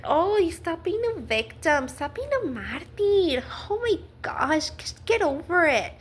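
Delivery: exasperated, singsong put-on scolding; mock dismissive advice. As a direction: mimic someone impatiently brushing off a victim. Mimics dismissive advice-givers.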